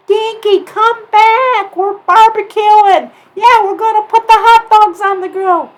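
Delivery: high, loud shouting call; yelling up to a friend flying away; calling up to the sky. A narrator's reading. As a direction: friends calling Dinky back down. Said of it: "looking up at him going".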